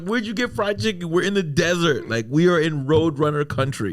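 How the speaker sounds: high, comically baffled outburst; incredulous. Acted. Stranded driver asking rescuers about fried chicken, incredulous.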